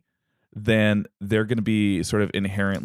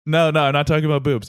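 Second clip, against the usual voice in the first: higher, fast, flustered denial; defensive protest; 5 semitones higher, 3 dB louder, faster than usual. Acted. Husband answers Hera's accusation, quick and defensive. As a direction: hurriedly fend off an accusing wife. Zeus defends himself to wife.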